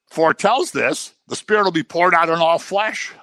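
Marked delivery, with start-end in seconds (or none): none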